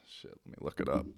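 whispered quick aside; muttered aside. Real, not acted. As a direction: mutter it to yourself. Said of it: "aside while searching phone".

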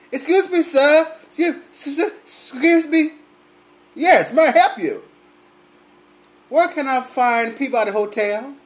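sweet and timid like a lost visitor; polite tourist voice. Retold or imitated. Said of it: "tourist voice".

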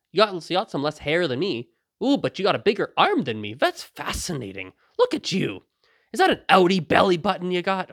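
high, playful marvelling; mock fascination. Sizing up a naked troll's body, mock-fascinated. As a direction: inspect and marvel as if innocently intrigued.

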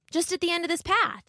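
put-on high-pitched little girl; Little Red. A narrator's reading. Little Red politely thanking the wolf, high girlish voice.

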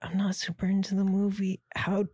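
high, rising baffled self-quote. Retold or imitated. Retelling own baffled thought.

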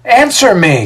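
high, loud exasperated demand; exasperated yell. A narrator's reading. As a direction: demand an answer, patience gone. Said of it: "yells out in exasperation".

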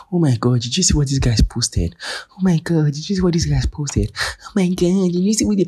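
high, breathless gushing gossip; mock the excited Instagram fan.

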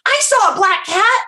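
put-on high-pitched eager child voice; little kid. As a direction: pipe up like a helpful little kid. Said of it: "like, little kid comes out of nowhere".

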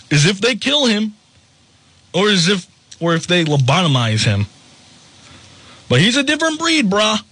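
usual speaking voice

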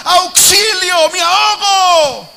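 high, loud and slowed, building to Peter's cry; preacher building to a desperate shout; shouted preaching. Acted. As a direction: cry out like a drowning man. Preaching at full force.

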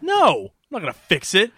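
high-pitched, acted-out protest; mock indignant refusal. Arguing back over the broken car, mock indignant.

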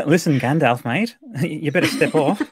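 put-on cocky Australian accent, laughing through it. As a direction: lay on a broad Australian accent. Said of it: "Or maybe Australian".

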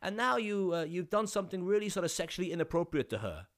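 a hint of a Dutch director urging him on; Dutch accent. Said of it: "I think he was Dutch-ish".

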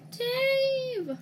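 high, loud call across the map; shouting a name. Real, not acted. Calling out to teammate.

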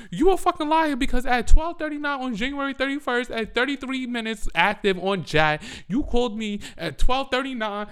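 high, loud rant listing the evidence; acted angry accusation. Woman reading evidence back to boyfriend, loud accusing rant. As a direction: re-enact a furious confrontation, shouting. Re-enacts confronting cheating boyfriend.

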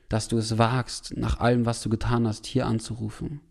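ordinary delivery

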